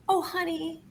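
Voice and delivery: put-on gently fob off a child with a feigned excuse; mock-polite refusal. Parent turning down a toddler's saved octopus heads, mock-polite.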